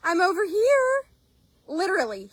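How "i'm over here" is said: high, squeaky horse's call to a human. Acted. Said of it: "He just nickered for her".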